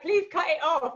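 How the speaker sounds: high, laughing, panicky plea; hammed-up pleading; mock panic. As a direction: beg in comic desperation. Retold panicked plea on live call.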